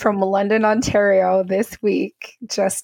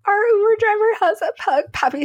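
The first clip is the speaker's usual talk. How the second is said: much higher, squealing with excitement; excited; 13 semitones higher, faster than usual. Retold or imitated. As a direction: blurt it out like huge news. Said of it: "I'm like".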